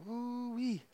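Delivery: high squealed ooh-wee; ooh-wee exclaim. Real, not acted. Preacher marveling at daughter's scream, high squeal. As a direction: exclaim it like remembering a piercing scream.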